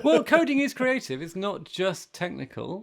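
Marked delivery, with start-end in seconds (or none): 0.0-1.2 laughing, much higher and louder. Real, not acted. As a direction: laugh through the protest. Defending coding, laughing.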